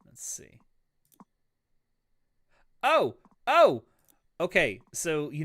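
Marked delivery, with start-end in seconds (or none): 0.0-0.6 whispering. Real, not acted. Quietly looking around in game. 2.8-3.8 sudden realization. Real, not acted. Suddenly finds the hidden tab.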